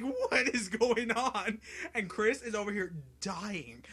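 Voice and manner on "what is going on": loud, high, drawn-out mock panic; losing his mind. Gamer retelling the boss fight to his friend, mock freak-out. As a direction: cry it out slowly in exaggerated bewilderment. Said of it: "I lost my mind".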